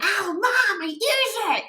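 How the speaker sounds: high-pitched imitation of a hurt kid; complaining son. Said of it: "I raised my voice and he's like".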